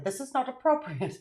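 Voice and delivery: light imitation of a German-accented scolding; slightly put-on German accent, reproving. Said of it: "You talk to her in a German accent?".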